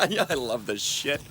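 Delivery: laughing before and after the words; gloating laughter. Acted. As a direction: laugh with relish at your own power. Captor laughs, enjoying his power.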